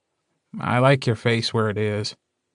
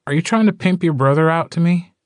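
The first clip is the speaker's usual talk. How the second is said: high, incredulous blurt; can't believe what he's hearing, pitched high; 7 semitones higher, faster than usual. Acted. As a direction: Gordo blurting at the alpha, high and disbelieving. Blurted disbelieving accusation.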